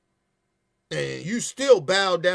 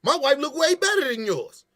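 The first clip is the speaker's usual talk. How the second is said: higher and louder, hammed-up jeering at a rival; mock a guy insulting another man's wife, loud and high; loud, high mocking taunt at a rival; mock trash talk; 10 semitones higher, 6 dB louder than usual. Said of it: "and you like".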